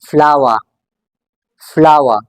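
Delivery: फ्लावर as "flah-wah", no R; British silent R. Shown as a demonstration. Teacher asking students how to pronounce it, silent R. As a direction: end फ्लावर on an open falling vowel. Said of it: "You have to keep this R silent".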